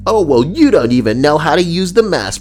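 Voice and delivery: acted-out put-down; mocking critic. Voicing a critic's argument.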